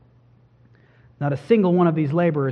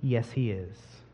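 much softer, slow, firm answer; resounding yes; 7 semitones lower, 12 dB quieter, slower than usual. Shown as a demonstration. Preacher answering his own question for the congregation, quietly firm. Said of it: "the answer to that question is a resounding".

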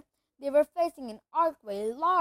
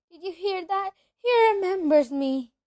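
higher, louder thrilled exclaiming; excited; 7 semitones higher, 8 dB louder than usual. A narrator's reading. Diggle telling the crowd, thrilled and louder. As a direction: tell the whole room, delighted.

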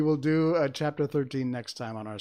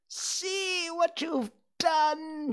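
very high, slow screech of a witch; screamed; much slower than usual. A narrator's reading. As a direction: screech it out, stretching each word. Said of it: "she screamed".

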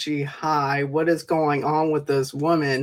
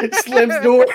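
normal talking voice

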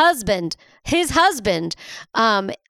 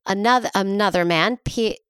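normal talking voice

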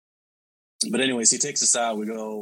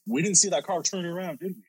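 higher-pitched worried checking; retold nervous question; 9 semitones higher than usual. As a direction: act out uneasily asking the back seat. Retold nervous question while fleeing.

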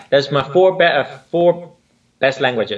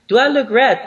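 high, louder mock-worried question; pitched-up imitation of an anxious question; imitating her question; 10 semitones higher, 5 dB louder than usual. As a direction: mimic her in a raised pitch. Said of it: "she says".

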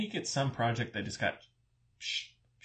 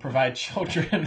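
trying not to laugh; 5 semitones higher, 5 dB louder than usual. Real, not acted. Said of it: "Sorry, I'm losing it".

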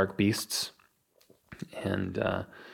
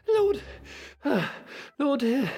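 put-on high-pitched, winded little boy; small boy. Boy who ran in announces the lords, high and panting. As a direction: gasp it out in bursts, very high. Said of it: "a small boy run into the room".

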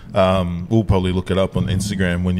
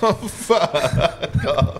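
slow words swamped by laughter; laughing disbelief; 6 semitones higher, much slower than usual. Real, not acted. Mate hearing how the night turned out, laughing helplessly. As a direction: groan it out through real laughter. Listener cracks up at story.

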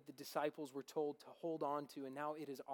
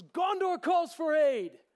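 much higher, loud heroic cry; yelling; 13 semitones higher, 15 dB louder, slower than usual. Acted. Aragorn shouting the news to King Theoden, full heroic yell. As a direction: shout it across the battlefield. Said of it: "he yells at him".

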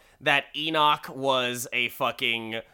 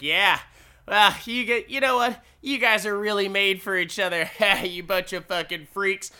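higher, fast sneering insult; hammed-up higher taunt; mocking sneer; 6 semitones higher, 3 dB louder, faster than usual. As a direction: sneer at them as freaks. Sickles mocking the couple.

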